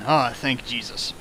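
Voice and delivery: ordinary talk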